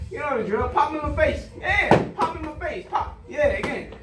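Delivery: loud, high, pumped-up pad calls; hype coaching. Real, not acted. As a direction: fire up the fighter with every call. Coach hyping up pad work.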